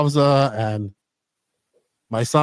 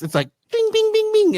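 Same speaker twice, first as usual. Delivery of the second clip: high, springy bing noises for a fast dog; rising playful pings as the dog darts about; bouncy sound effects. Retold or imitated.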